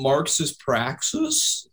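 slow, drawn-out mock pronouncement; mock solemn. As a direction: spell it out slowly, mocking. Mocking a virtue signaller.

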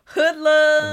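playful shout. Pretend. Co-host gleefully echoing a funny word, playful shout. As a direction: call it out big for fun.

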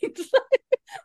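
high, helpless laugh; bright burst of real laughter. Real, not acted. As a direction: crack up at your own blunder. Laughing at her own blunder.

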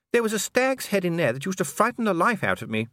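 high-pitched lady recalling home; reminiscing lady. Acted. Voices Lady Sybil in narration.

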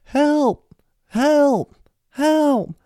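high, loud cries for help; screaming for help. Retold or imitated. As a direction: yell it like you're pinned down. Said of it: "he was screaming".

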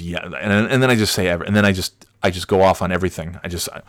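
usual speaking voice

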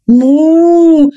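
loud moo; cow moo. Acted. Cow mooing mid-speech.

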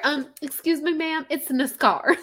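high, loud hammed-up Southern "nascar"; playful Southern drawl correcting a stranger; mock Southern accent.

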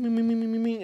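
high rapid string of bings; imitated casino noises. Describing the overwhelming noises of a crowded place, high mimicked dinging. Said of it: "there's noises and there's bing".

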